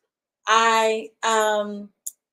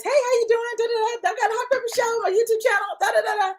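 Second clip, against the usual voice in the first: high bubbly host pitch; cheery intro; 11 semitones higher, faster than usual. Retold or imitated. Imitating her own upbeat welcome to an empty channel. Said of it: "trying to tell you guys".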